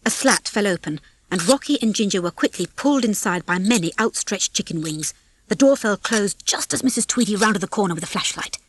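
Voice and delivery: usual speaking voice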